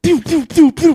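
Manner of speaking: loud, high imitated laser shots; stormtrooper blaster fire. Stormtroopers shooting at the fleeing Jawa, loud imitated blasters. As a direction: spit out rapid blaster pews. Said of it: "and the fucking stormtroopers just".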